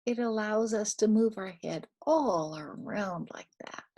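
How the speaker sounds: ordinary talk, nothing marked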